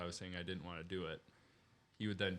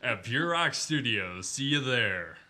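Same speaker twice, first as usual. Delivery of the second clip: slow, much louder announcer sign-off; 2 semitones higher, 10 dB louder, slower than usual. Pretend. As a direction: boom the closing line like a hype announcer. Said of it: "switch to your announcer voice".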